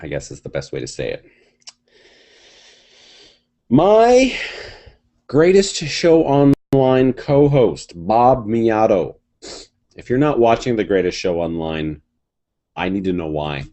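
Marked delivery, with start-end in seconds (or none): 3.7-9.1 announcer voice. Acted. Introducing co-host like a host.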